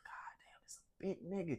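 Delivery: unvoiced whisper to himself; whispered thought. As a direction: whisper it like a private fear. Inner thought seeing big inmate.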